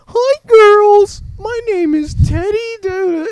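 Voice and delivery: very high, loud squeaky toy voice; piercing falsetto teddy greeting; teddy bear voice. Retold or imitated. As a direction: imitate a talking teddy, shrieking it high. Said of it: "he does a teddy bear voice".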